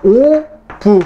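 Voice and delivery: "오브" in two drawn-out syllables; demonstrated reading. Shown as a demonstration. Teacher shows students how to read the symbol, saying "오브" slowly and distinctly. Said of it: "오브라고 읽으세요".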